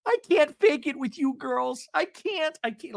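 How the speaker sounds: high, drawn-out dramatic confession; mock anguished admission; Gina. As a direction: mimic her in a high put-on voice. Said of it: "starts sounding like Gina".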